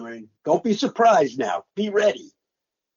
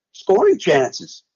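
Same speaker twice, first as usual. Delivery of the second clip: usual speaking voice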